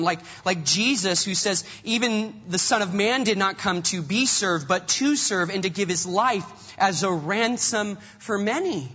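loud, driving sermon delivery; preaching at full force. Acted. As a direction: preach it hard to the congregation. Preaching with rising intensity.

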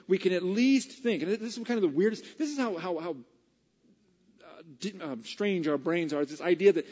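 loud, high, emphatic preaching; full-force preaching. Real, not acted. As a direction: preach it to the whole room.

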